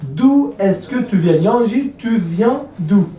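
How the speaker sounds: deliberate teacher's demonstration; slow classroom model. Shown as a demonstration. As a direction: spell the question out slowly for learners.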